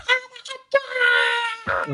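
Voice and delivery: overjoyed friend shrieking down the phone; excited scream. Retold or imitated. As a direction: squeal it like the best news ever. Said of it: "and she's screaming, and she's going".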